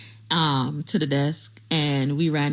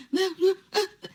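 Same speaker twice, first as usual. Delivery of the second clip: high laugh; 12 semitones higher than usual. Real, not acted. Laughing at stunned man.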